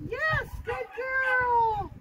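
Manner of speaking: slow, stretched-out happy praise. Shown as a demonstration. Trainer showing the happy voice to praise the puppy. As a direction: draw it out for her. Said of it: "Happy voice, you guys".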